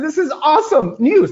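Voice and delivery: high, emphatic repeat of the news; delighted. Retold or imitated. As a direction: say it again, delighted.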